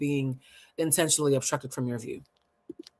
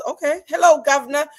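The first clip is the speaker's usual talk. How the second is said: high, hammed-up British accent; terrible put-on British lilt, pitched up; bad British accent; 9 semitones higher, 7 dB louder than usual. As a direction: greet them in a cringey mock-British accent. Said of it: "My British accent is complete trash".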